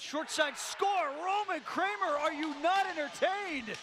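soaring, drawn-out goal shout; excited goal call. Real, not acted. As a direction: belt out the goal like the crowd just erupted. Goal call by play-by-play announcer.

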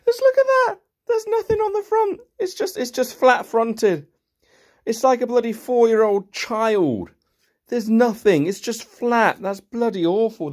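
high, loud, exasperated disgust at a cheap toy; mock outrage. Real, not acted. Reviewer ranting at a cheap toy car, outraged. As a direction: pitch it up and rant in disbelief.

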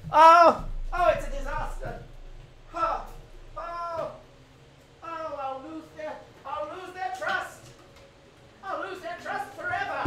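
hammed-up wail of despair, high and fading; mock despair. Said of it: "It's so dramatic".

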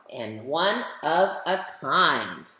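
ordinary delivery, nothing marked

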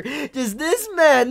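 ordinary talk

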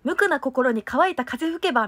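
crisp, over-enunciated stage recital of a lyric line; diction drill recital. Shown as a demonstration. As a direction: spit out every syllable like a warm-up drill. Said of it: "ろれつとか滑舌の練習めちゃくちゃしてた".

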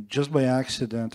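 normal talking voice

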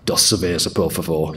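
low-pitched, blunt Yorkshire accent; Spanish with thick Yorkshire vowels. Shown as a demonstration. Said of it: "very strong Yorkshire accent".

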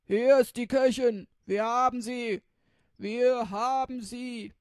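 loud, gleeful yelling robber; triumphant shout. Acted. Robber shouting from the kitchen that they caught her, loud triumphant yell. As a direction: bellow it out across the house.

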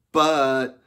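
one loud, high, drawn-out shout. A narrator's reading. Frederick calling to someone in the brush, shouted. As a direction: holler the word out. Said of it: "shouted Frederick".

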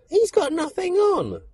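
put-on very high, piping child's voice; high-pitched childish exclamation; young boy. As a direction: call it out like a little boy. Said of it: "the one boy puts up his hands".

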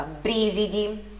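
"brividi" slowly, weight on BRI; first-syllable stress. Shown as a demonstration. Teacher naming the strong syllable, slow BRI-vi-di in "brividi". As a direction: stretch BRI, let vi-di follow lightly in "brividi". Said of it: "sílaba forte na primeira sílaba".

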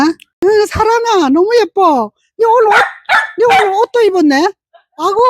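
high, sing-song cooing to a pet. Real, not acted. Owner cooing at her dog Sarang in high baby talk. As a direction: coo sweetly at the little dog.

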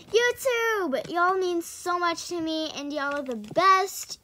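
loud, emphatic read-out; slow, loud declaration; heartfelt note read aloud. Shown as a demonstration. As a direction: proclaim it to the fans, stretching the key words. Reads a note aloud to viewers.

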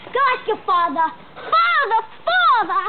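high put-on Australian mum; playful, rising-pitched Australian accent. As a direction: send the child off in an Australian accent.

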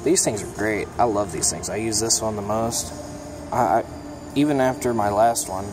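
usual speaking voice

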